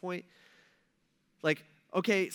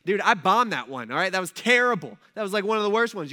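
a louder, exasperated "I bombed that one"; loud self-mocking protest; 7 dB louder than usual. Pastor answering a churchgoer's compliment, loud mock protest. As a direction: push back hard on the compliment, half joking. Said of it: "And I'm like".

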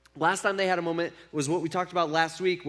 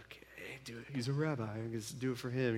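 much softer, lower resigned mumble; reluctant grumble; 6 semitones lower, 11 dB quieter than usual. Retold or imitated. Fishermen grudgingly humor the new rabbi, softer and lower. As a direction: give in with an eye roll.

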